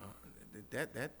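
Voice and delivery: wary Baptist. Retold or imitated. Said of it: "we're like".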